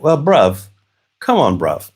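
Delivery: light imitation of British schoolkid slang; kids' British accent. Dad imitating his kids with British friends, light British accent. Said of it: "an entirely different accent".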